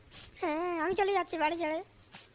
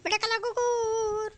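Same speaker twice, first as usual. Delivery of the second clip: drawn-out wail; 3 semitones higher, much slower than usual. Acted. Wife wailing again.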